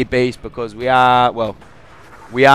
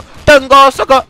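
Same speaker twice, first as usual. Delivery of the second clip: much higher, louder Tango Sucker in a mock Arabian game accent; 12 semitones higher, 7 dB louder than usual. Shown as a demonstration. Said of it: "in, like, the Arabian accent".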